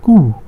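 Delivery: Kuh said as long "koo"; German letter Q. Shown as a demonstration. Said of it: "K U H".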